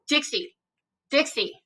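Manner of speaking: soft, quick call of a dog's name; quietly calling the dog away; hushed call to the dog. Real, not acted. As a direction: call the dog under your breath.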